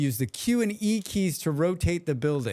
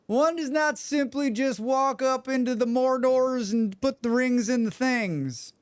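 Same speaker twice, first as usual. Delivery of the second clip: mock Southern accent; 8 semitones higher than usual. Streamer garbles the Mordor line for laughs, hammed-up Southern accent. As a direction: ham up a high-pitched Southern accent.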